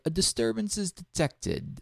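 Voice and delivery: high, soft, slow soothing game voice; soothing clockwork soldier. Retold or imitated. Player imitating a game's clockwork soldier spotting you, soothing voice. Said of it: "the most soothing voice".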